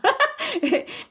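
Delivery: a sudden, sharp laugh that jumps way up; bright squeal of a laugh; high burst of laughter. Real, not acted. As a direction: laugh at yourself, high and quick. Laughing at her own panic.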